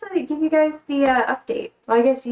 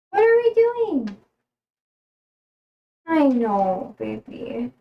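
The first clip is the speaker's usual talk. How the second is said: pet talk; slower than usual. Real, not acted. Addressing the dog.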